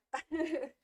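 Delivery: loud cackle right into the mic; cackling laugh. Real, not acted. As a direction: burst out cackling close to the mic. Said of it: "I think I just cackled right in your ear".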